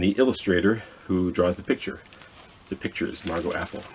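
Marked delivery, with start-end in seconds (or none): none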